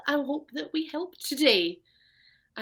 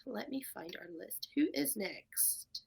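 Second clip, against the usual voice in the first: whispering; 12 dB quieter, slower than usual. Real, not acted. Host searching her list aside.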